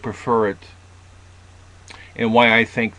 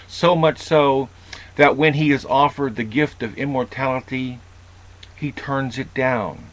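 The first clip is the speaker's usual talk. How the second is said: usual speaking voice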